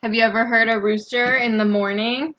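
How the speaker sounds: ordinary delivery, nothing marked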